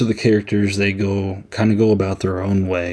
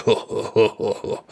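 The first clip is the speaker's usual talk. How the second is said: stoned chuckle. Retold or imitated. Stoners laughing in the van.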